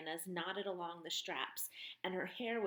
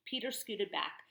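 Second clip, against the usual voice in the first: ordinary delivery, nothing marked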